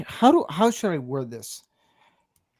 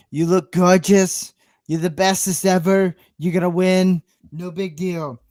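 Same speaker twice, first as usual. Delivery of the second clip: Donald Trump; 2 semitones higher than usual. Acted. Dad praising his prom-bound daughter in a Trump accent. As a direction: do a boastful politician's accent, stretching the compliments. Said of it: "I would do it in a Trump accent".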